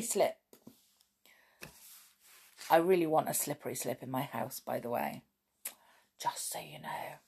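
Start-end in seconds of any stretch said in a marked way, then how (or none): 2.7-7.2 low, quiet aside to the listeners; muttered aside. Real, not acted. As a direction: drop the voice and tuck it in, trailing to a near whisper. Reader's private aside between passages.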